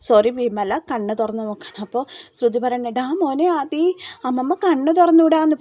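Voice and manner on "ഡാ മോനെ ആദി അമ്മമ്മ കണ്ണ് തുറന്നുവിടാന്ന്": higher, louder happy exclamation; retold burst of joy, raised and loud; joyful relief. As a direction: tell the boy the good news with relief. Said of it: "സന്തോഷത്തോട് കൂടിയിട്ട്".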